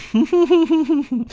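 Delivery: high, tittering cackle; character giggle. Acted. German character giggles at own dig, high titter. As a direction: titter high through a closed mouth. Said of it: "my old German voice".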